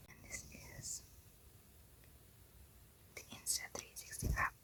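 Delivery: barely audible whisper. Real, not acted. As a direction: whisper it as if keeping it secret. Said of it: "Why am I whispering?".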